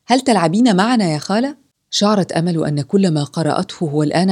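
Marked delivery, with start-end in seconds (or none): 0.1-1.6 put-on higher-pitched child's eager question; lightly voiced child shouting an invitation; child calling out. A narrator's reading. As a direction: mildly call it out as a child. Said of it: "ومن ثم صاح طفل آخر".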